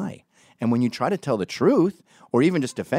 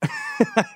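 high-pitched burst of giggles; self-mocking laugh. Real, not acted. After botching a Liverpudlian accent, high embarrassed laugh. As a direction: break up at your own attempt.

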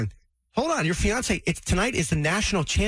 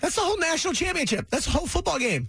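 much higher pitched, dismayed objection; alarmed protest. Real, not acted. Co-host protests a premiere clashing with his football game, high-pitched dismay.

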